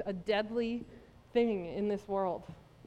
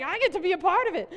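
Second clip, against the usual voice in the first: excited quote; 7 semitones higher, 6 dB louder, faster than usual. Retold or imitated. Retold own excitement, quoted.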